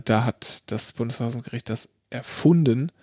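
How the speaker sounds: normal talking voice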